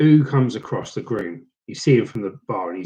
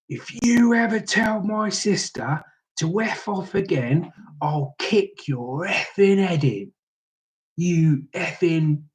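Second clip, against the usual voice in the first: slow, raised-pitch mock menace; imitated angry threat; 6 semitones higher, slower than usual. Drunk groom confronting the DJ over his sister, slow acted threat. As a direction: stretch each word out as an acted threat.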